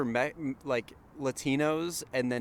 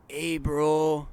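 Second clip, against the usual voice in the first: put-on Mexican accent; 3 semitones higher, 3 dB louder than usual. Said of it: "I just did the Mexican accent".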